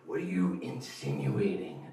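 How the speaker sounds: slow, deliberate mock squint; slow suspicious question. Friend questioning the bandmate, slow suspicious voice. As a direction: drag out each word suspiciously.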